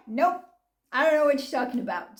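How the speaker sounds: fast, clipped-off brush-off; imitating a quick dismissive denial; rapid mock denial. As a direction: rattle off a flat denial. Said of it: "Flat out denial".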